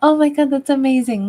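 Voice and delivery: be wowed by the news; delighted surprise. Real, not acted. Streamer amazed by a viewer's dice tattoo, delighted surprise.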